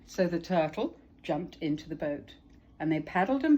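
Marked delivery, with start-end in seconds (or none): none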